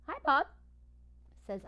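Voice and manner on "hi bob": high, loud happy hello; cheerful greeting. Acted. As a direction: greet an old friend brightly. Ivan greets Bob cheerfully.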